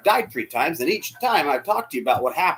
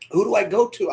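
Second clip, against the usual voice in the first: ordinary delivery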